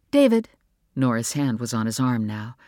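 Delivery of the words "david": higher, louder, sharp call of his name; warning wife. Acted. Wife cutting off husband's scolding, sharply. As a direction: stop him with his name.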